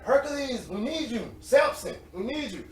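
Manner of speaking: high, insistent summons; mock calling out. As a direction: call out names as if needing muscle. Coworkers calling him for help.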